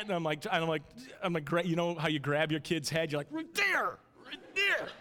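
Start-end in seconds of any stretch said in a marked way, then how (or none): 3.3-4.9 high, strained parent insistence; exasperated urging. Retold or imitated. Dad turning his daughter's head toward the rabbit, exasperated. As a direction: urge it as if steering a child's head.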